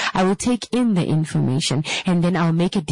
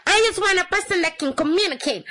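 whiny mock demand; 12 semitones higher, faster than usual.

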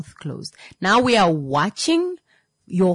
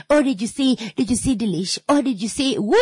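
high, excited gossiper impression; mock gossip; 5 semitones higher than usual. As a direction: imitate someone eagerly spreading gossip. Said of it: "talking about other people".

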